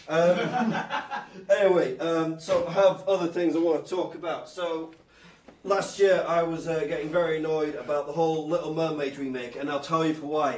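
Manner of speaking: ordinary talk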